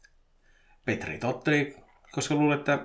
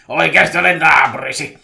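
loud, higher burglar's reveal; murtautuja; 4 semitones higher, 13 dB louder than usual. A narrator's reading. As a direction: announce it loudly as the intruder. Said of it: "murtautuja sanoi". (murtautujan kova paljastus)

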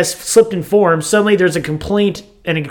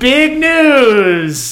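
announcing; 8 semitones higher than usual. Acted. Announcing big news.

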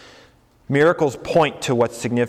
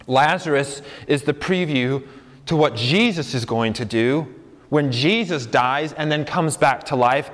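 ordinary talk, nothing marked

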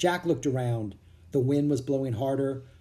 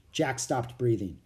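plain reading voice

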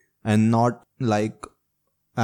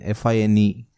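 usual speaking voice

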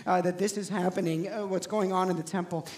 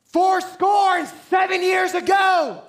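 much higher, much louder, slow shout; 14 semitones higher, 13 dB louder, slower than usual. Shown as a demonstration. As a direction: belt it out like a proclamation. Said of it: "but if I yelled out".

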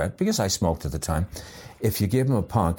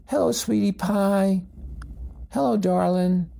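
much higher cooing puppy talk; baby talk; 12 semitones higher than usual. Retold or imitated. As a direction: coo at the puppy like a doting owner. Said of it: "they bring him up".

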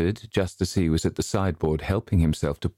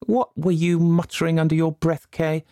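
higher, sharp, nagging governess voice; scolding governess; 12 semitones higher, 4 dB louder than usual. A narrator's reading. Governess scolding Kay at breakfast, higher and sharp. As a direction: scold the naughty boy relentlessly.

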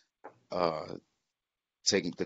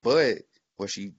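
ordinary talk

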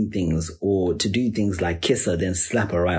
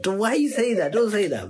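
squealing don't, don't; shrill embarrassed protest, much higher; high-pitched protest; 11 semitones higher than usual. Real, not acted. Protesting friend's teasing.

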